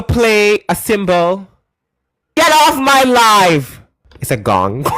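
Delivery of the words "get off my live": loud, slow, drawn-out yell; slow furious shout. Real, not acted. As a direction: bellow it, stretching each word. Angry at caller's gong sound.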